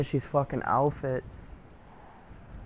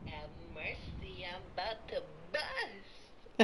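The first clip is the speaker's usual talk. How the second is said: much softer, laughing through the line; quiet retry; 17 dB quieter than usual. Shown as a demonstration. Player retrying friend's catchphrase, much softer and laughing. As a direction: mumble it, giving up. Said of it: "I can't do it".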